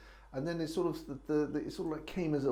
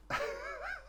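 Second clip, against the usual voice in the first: burst of laughter; 5 dB quieter than usual. Real, not acted. Breaks up after his accent.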